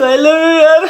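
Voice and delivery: high, loud hammed-up I love you; mock declaration of love. Joking to friend he blurted out love, hammed-up loud. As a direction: belt it out as a fake confession. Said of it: "मजाक कर रहा हूँ, ये नहीं बोला मैंने".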